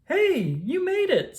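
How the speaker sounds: high, drawn-out cheer of welcome; excited greeting. A narrator's reading. Bill cheers his friends arriving at the start line, excited. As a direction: greet them like they barely made it. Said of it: "said Bill".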